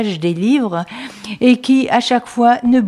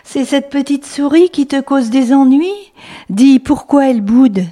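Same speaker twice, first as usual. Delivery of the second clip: higher, louder read-aloud refrain; storytelling refrain; 6 semitones higher, 4 dB louder than usual. Acted. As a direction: call out the refrain to kids. Refrain sung out to the mouse.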